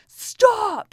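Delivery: put-on sudden high, loud bellow at the kids; one-word yell to stop the kids; mom bellowing voice. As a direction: blast it out like a fed-up mom. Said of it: "my deep mom bellowing voice".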